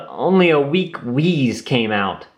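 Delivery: ordinary delivery, nothing marked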